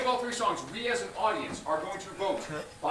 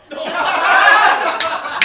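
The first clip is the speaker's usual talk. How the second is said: high, loud burst of laughter; 9 semitones higher, 13 dB louder than usual. Real, not acted. Host laughs at contestant choice.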